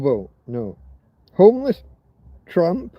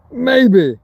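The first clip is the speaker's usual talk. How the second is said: a loud, emphatic maybe; first take, punch it out loud; 7 semitones higher, 6 dB louder than usual. Shown as a demonstration. Demonstrating what maybe means, loud and emphatic. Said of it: "this is what maybe means".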